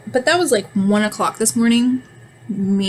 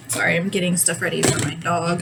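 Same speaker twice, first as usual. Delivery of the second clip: ordinary talk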